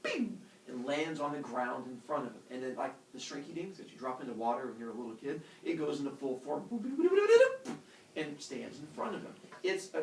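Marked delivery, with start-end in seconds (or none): 0.0-0.4 little pop-out sound effect; ping it out high and short; high-pitched bing. Acted. Little person jumps from Zeus's brain, high "bing". 6.7-7.8 high, loud run of boops climbing higher; rising boop effect. Acted. Storyteller shows tiny figure growing, climbing loud boops.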